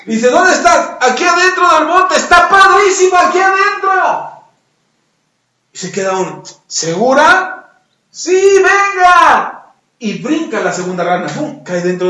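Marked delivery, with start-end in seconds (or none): 0.3-1.0 high, loud searching call. Acted. Frog outside looks for its friend, calling out. 1.0-4.3 high, loud come-on from afar; calling out. Acted. Trapped frog lures its friend in, calling out. 6.7-7.8 doubtful call. Acted. Frog outside checks with friend. 8.1-9.5 high, loud, long-drawn beckoning; calling out. Acted. Trapped frog urges its friend to jump, calling out.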